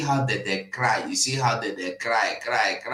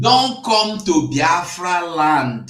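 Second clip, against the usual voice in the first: 1 slow shouted warning; 8 semitones higher, 7 dB louder, slower than usual. Real, not acted.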